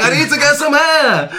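squeaky, flustered and breathless; high panicky voice. Retold or imitated. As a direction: gasp it out in a panic. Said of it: "And it's like".

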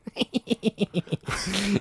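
breathy, voiceless sniggering; real stifled fit of snickering. Real, not acted. Guest reacting to his third song choice, sniggering. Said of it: "I snigger at this".

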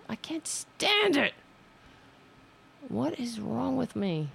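high, put-on whiny voice; exasperated whine. Real, not acted. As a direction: whine at yourself. Self-frustration after mistake.

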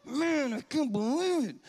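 grumbling. Retold or imitated. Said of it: "I start to grumble".

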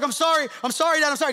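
hammed-up panicky sorry; mock weeping apology. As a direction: plead like a scared kid, played for laughs. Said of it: "he just starts weeping".

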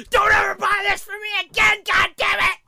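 put-on boy screaming at his parents over the toy; screaming tantrum. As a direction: scream it like a furious child. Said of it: "He starts screaming".

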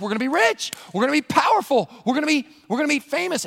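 high-pitched, rising excitement; thrilled, tumbling ahead to each new prize; giddy disciples. Acted. Disciples dreaming of their future power, giddy and high. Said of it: "They're like".

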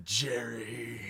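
low, quiet seething sneer; Newman voice. Retold or imitated. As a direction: growl the name with disdain. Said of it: "my very intense Newman".

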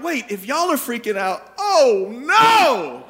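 high-pitched alarm, swelling to a loud wail; hammed-up dawning dread, loud at the end; mock panic. Isaiah seeing the seraphim freak out, mock panic.

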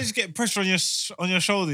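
ordinary talk, nothing marked

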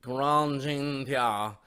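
Karine Jean-Pierre drawn out in mock French; slow, pretentious French take on Karine Jean-Pierre; mock fancy pronunciation. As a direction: stretch Karine Jean-Pierre slowly, mocking its fanciness. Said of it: "Nobody fucking called you".

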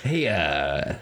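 low, hesitant police commissioner; Commissioner Gordon. Acted. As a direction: broach an awkward subject in a low official voice. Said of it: "Commissioner Gordon's like".

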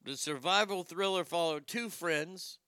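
ordinary talk, nothing marked

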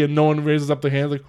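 usual speaking voice

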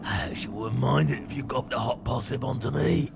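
confiding Cockney rat. Acted. Rat admitting he wouldn't mind it, confiding Cockney rat. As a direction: confess shyly as a Cockney rat. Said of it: "as long as it's a Cockney rat".

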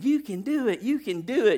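high, peppy cheerleader voice. Retold or imitated. Preacher mimics the cheerleader James is not, peppy cheer. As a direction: chirp it like a cheerleader. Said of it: "like the cheerleader saying".

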